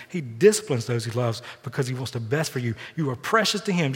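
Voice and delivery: ordinary talk, nothing marked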